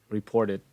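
reported with the T softened to a D; American D sound. Shown as a demonstration. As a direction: say reported as "re-POR-ded". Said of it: "mà người Mỹ hay nói này". (reported kiểu Mỹ, âm T thành âm D)

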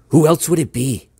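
loud, high, exasperated shout; a frustrated cry, pitched high and loud; frustrated outburst. Acted. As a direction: burst out in frustration, loud enough to turn heads. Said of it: "Heads turned our way and I lowered my voice".

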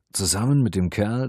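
ordinary delivery

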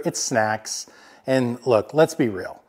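normal talking voice

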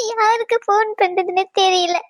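worried wife sobbing to a friend on the phone; tearful. Acted. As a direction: voice breaking with tears. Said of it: "அழுவாத".

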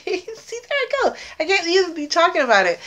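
real tearful high voice, fighting back tears; starting to cry. Real, not acted. Thanking a friend for the gift, starting to cry. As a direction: voice jumping high, tearing up. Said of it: "try really hard not to cry".

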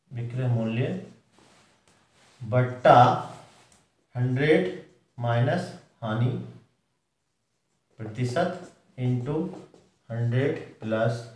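slow, deliberate teacher dictation, pausing between terms. Shown as a demonstration. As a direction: spell out the formula slowly for note-taking. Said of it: "तो इसके लिए formula है". (धीरे-धीरे बोलकर लिखवाना)

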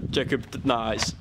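normal talking voice

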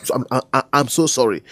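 ordinary talk

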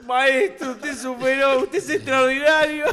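high, emphatic imitation through laughter; retold gushing praise. Toscano stands to answer the old man, laughing effusive imitation. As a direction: gush admiringly, close to cracking up. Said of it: "Y Toscano se paró y dijo".

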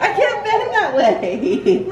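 high, slow giggly protest; laughing strain. Real, not acted. Owner squashed by her dog, laughing. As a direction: laugh as the dog pins you.

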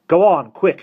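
high, loud breathless call to hurry; gasped urgent. Acted. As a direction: gasp it out while running, urging them on. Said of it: "he gasped".

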